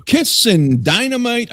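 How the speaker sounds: high, slow hyped-up title call; announcing the next track with a big hype voice; announcer hype. Acted. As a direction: sell the song title big.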